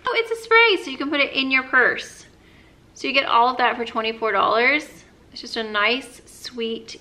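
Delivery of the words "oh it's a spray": surprised. Real, not acted. Discovers it sprays.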